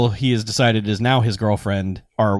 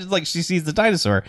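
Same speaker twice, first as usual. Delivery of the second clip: normal talking voice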